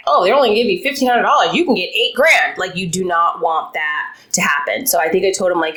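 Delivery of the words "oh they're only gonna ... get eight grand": fast, higher gossiping voice; gossiping friend. Retold or imitated. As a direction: egg them on like a nosy friend. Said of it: "and they're like".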